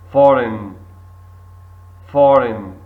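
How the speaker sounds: foreign said for-in, G silent, loud; model word. Shown as a demonstration. Teacher models foreign loudly for repetition. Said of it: "pronunciation practice".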